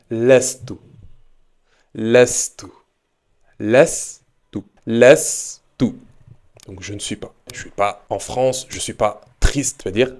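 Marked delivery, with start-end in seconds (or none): 0.1-5.8 Lastu repeated clearly, rising at the end; model pronunciation. Shown as a demonstration. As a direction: repeat Lastu slowly for imitation. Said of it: "tu vas plutôt utiliser". (prononciation modèle de lastu, finale montante)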